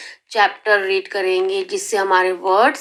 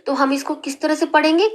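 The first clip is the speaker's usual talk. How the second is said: ordinary talk, nothing marked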